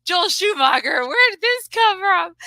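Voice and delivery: high-pitched, incredulous question; mock amazement. Recalling a reaction to the director's sexist line, mock amazement.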